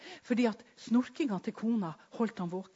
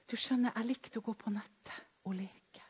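soft, hushed whisper; the man's hushed whisper to the preacher; whispered confession; 7 dB quieter than usual. Retold or imitated. Possessed man confessing.

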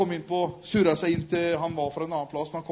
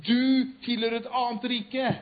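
normal talking voice

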